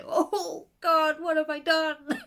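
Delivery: high, laughing "oh God" gasp; a laughing cry of mock dismay; mock horror. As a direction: gasp at your own creation in mock horror. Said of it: "it kind of went".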